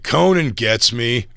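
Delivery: slow, smug mock manager; imitating manager. Manager brushing off Bill, slow and smug. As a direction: drag it out smugly. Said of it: "He's like".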